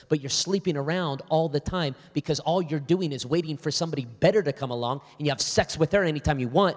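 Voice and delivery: retold outburst building to its loudest; angry rant. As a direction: tear into him, rising in anger. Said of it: "I lost my temper".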